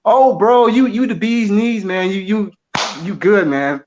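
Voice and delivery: butter him up like an eager fan; mock fawning praise. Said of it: "if you'd even say".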